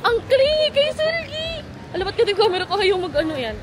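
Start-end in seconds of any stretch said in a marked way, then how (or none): 0.1-1.5 high, slow squeal of delight; excited squeal. Real, not acted. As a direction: squeal it, gushing. Squealing over clingy pet.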